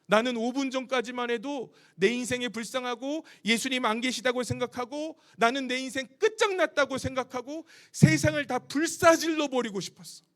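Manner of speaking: ordinary talk, nothing marked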